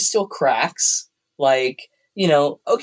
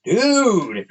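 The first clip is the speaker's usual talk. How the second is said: long drawn-out amazed exclamation, pitched up; high, stretched mock amazement; Eugene; 6 semitones higher than usual. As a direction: imitate an awestruck buddy. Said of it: "Eugene was like".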